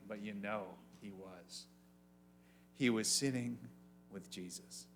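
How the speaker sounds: soft, low and quiet; hushed. Acted. Preacher lingering on the man sitting with Jesus, hushed. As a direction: hush it reverently.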